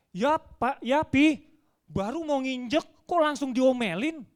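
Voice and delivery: put-on high, loud child's whine of protest. Scolded child answering father at the gutter, loud protest. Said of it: "Anak saya ngomong apa?".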